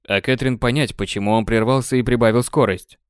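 ordinary delivery

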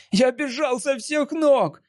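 high, slow, out of breath; panting shaky eyewitness. A narrator's reading. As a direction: gasp it out after running. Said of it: "задыхаясь, говорил Джимми".

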